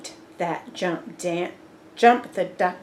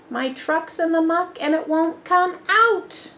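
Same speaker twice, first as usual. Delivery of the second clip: mild shout; 7 semitones higher, 3 dB louder than usual. A narrator's reading. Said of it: "hears the shout".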